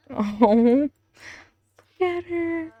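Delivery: ordinary talk, nothing marked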